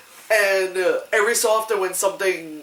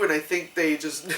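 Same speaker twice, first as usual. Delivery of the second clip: ordinary talk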